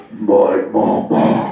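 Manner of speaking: low slow laughing "my mama"; mock mom voice. Mimicking mom's voice.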